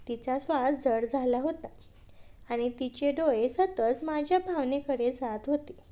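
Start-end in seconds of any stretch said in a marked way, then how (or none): none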